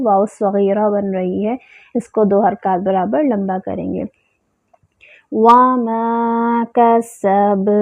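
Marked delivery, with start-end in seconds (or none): 5.3-7.7 وَمَا with rounded lips and a long madd; tajweed demo. Shown as a demonstration. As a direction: draw out وَمَا slowly. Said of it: "ہونٹوں کو مکمل گول کریں گے".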